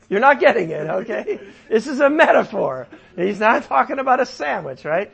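loud, high, amused protest; mock exasperation. Real, not acted. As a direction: raise your voice as if they are hopelessly missing it, half laughing. Teasing listeners who miss the metaphor.

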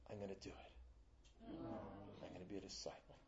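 hushed. Retold or imitated. Girl's tearful decision, retold.

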